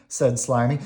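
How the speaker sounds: usual speaking voice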